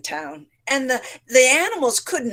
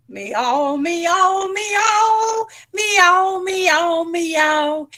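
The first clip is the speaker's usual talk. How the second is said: cat meowing; 8 semitones higher, much slower than usual. A narrator's reading. Storyteller meowing as Cat to the children. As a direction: sing out like a cat, climbing high. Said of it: "And then cat went".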